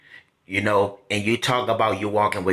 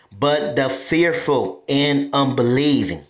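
slow, deliberate scripture reading; very clear, slow; slower than usual. Shown as a demonstration. Preacher rereads the verse to make it clear, slowly. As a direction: read it out slowly, word by word. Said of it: "I'ma say slow".

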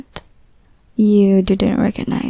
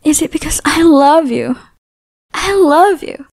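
higher, louder cry of love; manic declaration; 6 semitones higher, 5 dB louder than usual. Acted. Obsessive lover declaring love, higher and louder. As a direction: burst out with love, unhinged.

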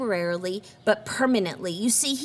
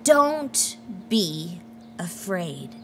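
tender reassurance; 4 semitones lower, much slower than usual. Acted. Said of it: "and he said".

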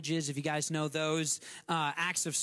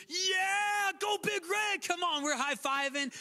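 excited fan cheer; 13 semitones higher than usual. Retold or imitated. Husker fan greeting another fan, excited high cheer. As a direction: cheer like you spotted a fellow fan. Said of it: "what do we do".

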